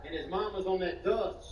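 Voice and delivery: usual speaking voice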